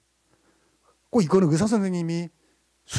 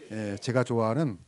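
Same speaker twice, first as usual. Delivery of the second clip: ordinary talk, nothing marked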